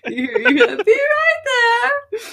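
tiny high voice, laughing; squeaky little voice, giggling; twinkling breathy fairy whisper. Acted. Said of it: "That's not the same voice".

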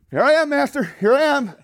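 high, loud, hurried-to-serve reply; eager servant. Acted. Little attendant answers his master, eagerly calling out.

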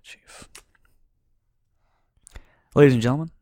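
usual speaking voice

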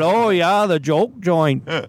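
put-on broad Minnesota accent, playful. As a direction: lean into the Minnesota vowels. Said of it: "making my Minnesota accent come out".

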